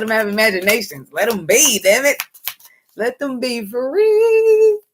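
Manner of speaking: high, slow, soaring call; impassioned plea. Real, not acted. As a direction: stretch out each word like a cry.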